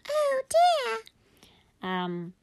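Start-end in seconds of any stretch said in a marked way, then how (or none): 0.1-1.0 very high, tiny squeak; squeaky-voiced dismay; squeaky mummy voice. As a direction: sigh the words as a squeaky toy would. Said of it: "She didn't hear the".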